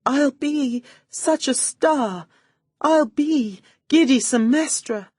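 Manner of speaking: high, slow put-on weepiness; tearful act. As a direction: fake tears to win sympathy. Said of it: "I paused to sniff".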